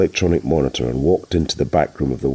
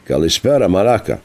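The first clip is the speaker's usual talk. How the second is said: usual speaking voice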